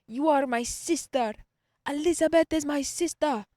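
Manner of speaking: imitating professor's vaguely African accent, high and loud. Student imitating her old professor claiming her as sister, African accent. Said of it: "I guess he was African or something".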